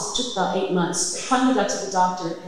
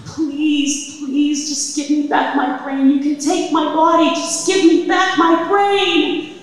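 desperate prayer; 6 semitones higher, 5 dB louder than usual. Retold or imitated. Praying to God for her life.

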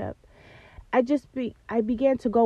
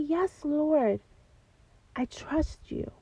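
softer and softer, ending in a whisper; sincere whispered answer; 3 semitones higher, 5 dB quieter, much slower than usual. Retold or imitated. Answering God in prayer, hushed and sincere.

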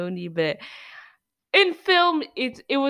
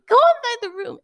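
high loud urgent command; loud high urgent order; urgent shout; 13 semitones higher, 8 dB louder than usual. Retold or imitated. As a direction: yell them into the room. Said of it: "I'm like".

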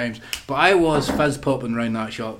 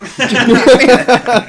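much higher, louder and slow, laughing; hammed-up teasing squeal; 11 semitones higher, 12 dB louder, much slower than usual. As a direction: stretch it out in a laughing mock squeal. Mocking innuendo, repeated.

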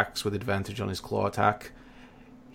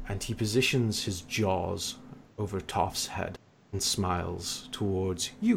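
usual speaking voice

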